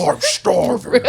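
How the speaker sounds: drawn-out, high comic squawk; hammed-up, slow sketch wail; Gap Girls. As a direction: stretch it out through laughter. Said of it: "Doing the Gap Girls".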